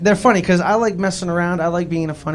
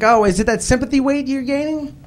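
much higher, teasing question; higher, sing-song busybody voice; nosy acquaintance; 9 semitones higher than usual. Retold or imitated. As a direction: ask it like a prying well-wisher. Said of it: "they're like".

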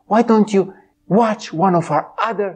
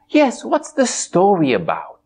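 ordinary delivery, nothing marked

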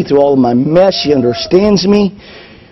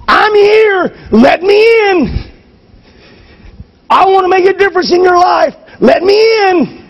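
pleading voice of God; 13 semitones higher than usual. Acted. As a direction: cry out to be let in. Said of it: "He's right here going".

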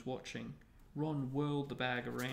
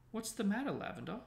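high, concerned question; anxious; 9 semitones higher, 4 dB louder, faster than usual. A narrator's reading. Hermione asks her crying friend what happened, anxiously. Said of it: "said Hermione anxiously".

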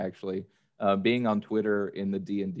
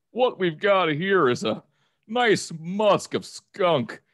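Kronk cologne ad; 8 semitones higher, 5 dB louder than usual. Pretend. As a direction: sell the skunk musk like a dopey hero. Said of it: "Can you please sell me cologne as that guy?".

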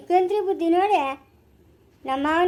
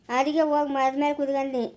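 ordinary delivery

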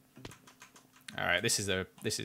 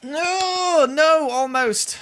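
real frustrated wail, much higher, slow and drawn out; annoyed wail; 8 dB louder, much slower than usual. Real, not acted. Gamer wails at failing the ladder jump, real annoyance. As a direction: cry out as the jump fails again. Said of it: "Sorry, I'm getting annoyed".

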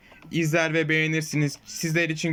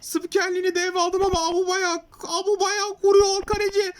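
much higher, shouted goal call; screaming football commentator; 10 semitones higher than usual. Acted. Takes over commentary, goal scored.

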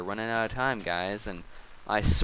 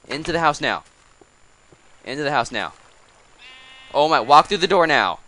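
loud, high herding call; shouting orders; 5 semitones higher, 10 dB louder, faster than usual. Real, not acted. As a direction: shout them into the house. Herding animals, calling out.

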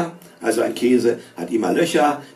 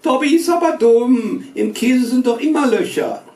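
put-on girls' voice; 12 semitones higher, 4 dB louder than usual.

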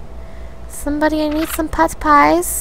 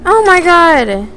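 1 panicked; 6 semitones higher, 7 dB louder, slower than usual. Real, not acted. Attacked, panicking.

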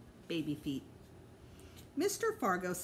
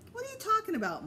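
exasperated mom; 4 dB louder, faster than usual. A narrator's reading. Mom dismissing the boys' talk.

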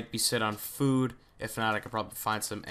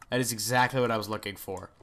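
normal talking voice

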